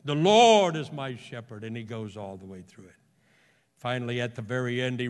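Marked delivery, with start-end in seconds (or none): 0.0-1.6 loud, grand orator's recitation; melodious orator. Retold or imitated. Orator reciting the Psalm to a crowd, melodious and grand. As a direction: imitate an orator swelling on LORD. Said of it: "he began with his melodious voice".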